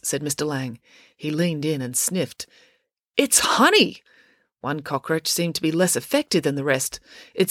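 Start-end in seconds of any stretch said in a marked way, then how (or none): 3.2-4.0 high exclamation of surprise; surprised discovery. Acted. Official sniffs the substance on the cockroaches, surprised exclamation. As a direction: announce the surprising find, pitched up.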